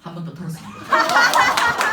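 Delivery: high, slow laugh-through punchline; laughing as the joke lands; laughing confession. Real, not acted. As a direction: crack up while admitting it.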